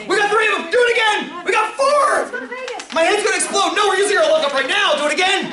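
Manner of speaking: high-pitched, thrilled exclaiming; real giddy excitement, voice way up; excited yelling. Real, not acted. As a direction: exclaim as if your head will explode. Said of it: "Sorry, I'm yelling".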